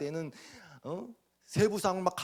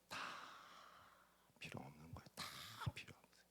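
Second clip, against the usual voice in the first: unvoiced hushed aside; whispered, almost to yourself; 12 semitones lower, 22 dB quieter, faster than usual. Real, not acted. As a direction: drop to a bare whisper. Whispered point in sermon.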